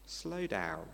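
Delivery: soft, low, calming voice; gentle. Acted. Genesis answering the knee-jerk objector, gently. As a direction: gently ease them to a halt. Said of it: "Genesis one just gently says".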